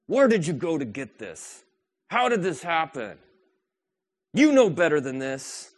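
picking out a speck while lecturing, mock scolding; judgmental scolding. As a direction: scold them like a nosy critic. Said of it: "to say".

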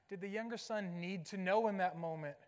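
normal talking voice

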